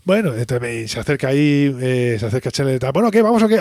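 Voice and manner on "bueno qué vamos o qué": high, louder pushy urging; impatient call. Retold or imitated. Chandler impatiently urging Joey to get back to the game, high and loud. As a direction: hurry them along, pitched up and loud.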